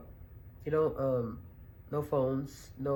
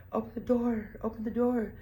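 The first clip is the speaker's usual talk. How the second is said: mock police demand; 8 semitones higher than usual. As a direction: bark it like cops at the door. Police pounding on door.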